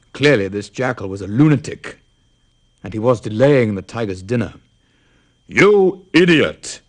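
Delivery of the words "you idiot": slow, loud, high tiger bellow. Acted. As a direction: roar it out as the furious tiger. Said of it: "bellowed the tiger".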